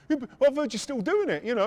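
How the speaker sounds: high, loud imitation of him; insistent doorman. The doorman challenges the excuse.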